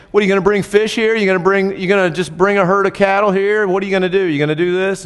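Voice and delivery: hammed-up disbelief at God's plan; incredulous Moses. Moses doubting God in the desert, mock exasperated. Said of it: "Moses even goes through the explanation".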